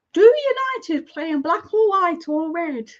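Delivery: high, slow mock-naive question; mock clueless owner. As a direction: imitate an owner asking a daft question, drawn out. Imagined clueless owner asking.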